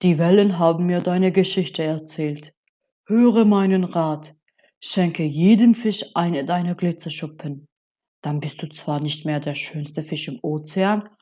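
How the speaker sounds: lightly voiced old octopus giving advice; measured, solemn counsel from the wise octopus; a hint of an old sea sage; deep octopus voice. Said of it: "sagte Oktopus mit tiefer Stimme".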